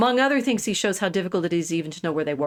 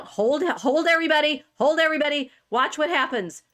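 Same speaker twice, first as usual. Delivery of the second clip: excited; 7 semitones higher, 3 dB louder than usual. Real, not acted. Dramatic build-up before map change.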